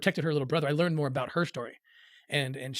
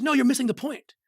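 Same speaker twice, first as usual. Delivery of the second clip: firm correction; 8 semitones higher than usual. Retold or imitated. Father corrects the speaker firmly.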